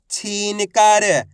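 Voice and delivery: ordinary delivery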